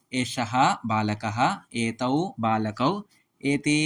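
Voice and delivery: slow, deliberate chanting of pronoun phrases; slow recitation. Shown as a demonstration. Teacher reciting Sanskrit pronoun phrases to students, slowly. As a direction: say each pair slowly for students to copy.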